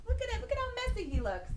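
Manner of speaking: playful disgust; mock shock. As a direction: gasp at the mess for the kids. Mock shock at messy boy.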